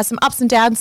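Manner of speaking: usual speaking voice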